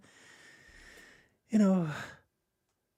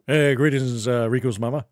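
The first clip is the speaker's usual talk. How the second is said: Johnny Bravo impression; 3 dB louder than usual. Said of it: "Doing Johnny Bravo impression".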